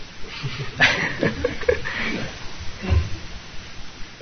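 light, breathy chuckle; laugh. Real, not acted. Teacher amused by the snake staring at Buddha, laughing. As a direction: chuckle to yourself at the picture.

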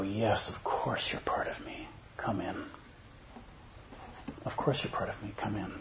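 soft whispered welcome; gentle whisper. Retold or imitated. Buddha welcoming Mara in, whispered and gentle. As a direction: hush it like welcoming a guest. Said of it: "Buddha ... and go".

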